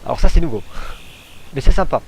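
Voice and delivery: high, loud and thrilled; excited. Real, not acted. Player to viewers about the new feature, loud excited delight. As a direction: gush over the new find.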